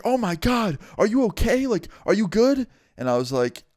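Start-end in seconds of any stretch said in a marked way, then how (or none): 0.0-2.7 high-pitched imitation of a panicked vice principal; mock alarm, pitched way up but not shouted; freaking out. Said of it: "vice principal starts freaking out".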